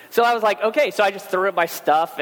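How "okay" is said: falling, accepting okay; resigned okay. Retold or imitated. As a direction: settle into it and give in. Resigned to wearing clown nose.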